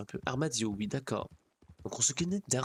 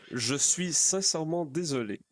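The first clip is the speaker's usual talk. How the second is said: slow, slightly theatrical apology, pitched up; in-character apology; 6 semitones higher, 4 dB louder than usual. Acted. Character apologizing to companions on arrival, slow in-character apology. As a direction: draw out the apology, lightly in character. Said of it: "Je retourne dans mon personnage".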